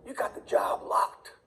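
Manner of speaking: breathy conspiratorial whisper. Acted. Career coach confiding the winning trick to viewers, whispered. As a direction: let them in on a secret, whispered. Secret tip to job seekers.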